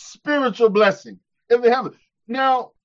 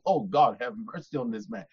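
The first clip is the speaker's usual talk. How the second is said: much softer, lower pleading murmur; silent inner prayer; 10 semitones lower, 9 dB quieter than usual. Retold or imitated. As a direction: pray under your breath for mercy. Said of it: "I'm praying on the inside".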